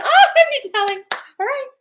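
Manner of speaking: high, loud, laughing delight. Real, not acted. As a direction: gush with laughter. Delighted by a comment.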